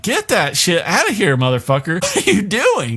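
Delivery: high, laughing freak-out; mock panic; panicky yelp. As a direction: yelp in alarm. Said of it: "Lloyd's just kinda like".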